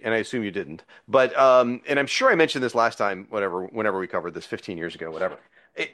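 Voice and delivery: ordinary delivery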